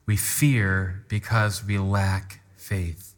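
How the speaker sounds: "We fear because we lack faith" drawn out very slowly; slow call-and-response reading; slow unison recital. Shown as a demonstration. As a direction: lead the room through it word by word. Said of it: "Everyone say that with me".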